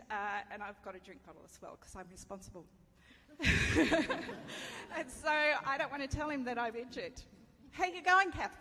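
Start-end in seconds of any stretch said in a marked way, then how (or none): 3.1-4.9 loud rising laugh at herself; real laugh. Real, not acted. Telling the congregation about her pride, laughing. 7.7-8.6 high, loud cheery call up the trail; husband calling back. Retold or imitated. Husband walking ahead calls back to his wife, cheery.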